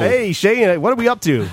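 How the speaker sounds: lightly hammed-up radio DJ; cheesy morning radio host. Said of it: "radio voice".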